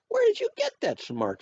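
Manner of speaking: very high-pitched mother asking; high, marvelling question; impressed question. A narrator's reading. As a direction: ask it way up high, amazed.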